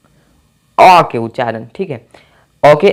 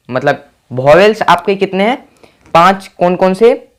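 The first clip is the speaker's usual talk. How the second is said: ordinary talk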